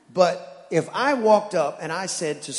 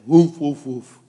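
imitated bark; 3 semitones lower, 8 dB louder, slower than usual. Imitating barking dog.

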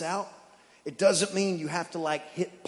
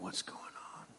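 whispered question; whispered dog; 20 dB quieter, faster than usual. Retold or imitated. Dog behind the door wondering, whispered.

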